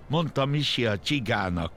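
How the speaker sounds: plain reading voice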